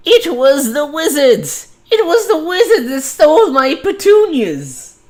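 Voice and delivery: put-on old man rants that wizards stole his petunias, shrieking high and loud; senile old man. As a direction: screech it like a doddering old man blaming wizards. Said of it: "the voice for him is like".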